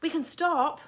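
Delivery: high, loud call from afar; yelling. A narrator's reading. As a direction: yell it back from up ahead. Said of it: "Miles yelled from further".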